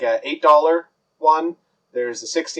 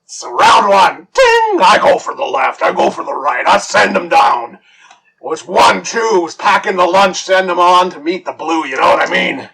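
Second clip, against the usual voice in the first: louder bragging brawler; boastful champ; 4 semitones higher, 5 dB louder than usual. Acted. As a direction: brag about the fight. Big Joe bragging about fight.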